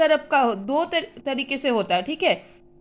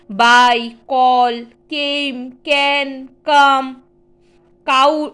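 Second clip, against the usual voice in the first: each word read slowly and clearly, one at a time; word drill; 3 dB louder, much slower than usual. Shown as a demonstration. Teacher reading useful words aloud to the class, clear and measured. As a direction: call out each word firmly for the class to repeat.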